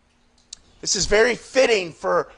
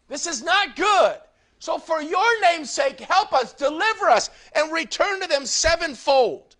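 higher, impassioned pleading prayer; rising preacher fervor; fervent preaching; 7 semitones higher than usual. Acted. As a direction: plead with God from the pulpit. Preacher prays fervently for the people.

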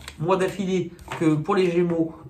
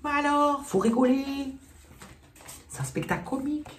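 high-pitched comic sketch character; mock cacou voice; 10 semitones higher than usual. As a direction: squeal it like a caricature in a skit. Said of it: "On dirait les cacos".

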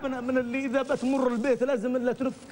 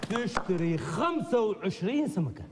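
slow sales pitch; 3 semitones lower, slower than usual. Pretend.

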